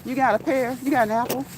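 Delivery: cheeky guest asking for food; mock demand. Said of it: "I'm gonna be like".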